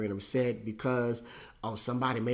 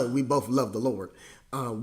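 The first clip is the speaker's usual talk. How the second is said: ordinary talk, nothing marked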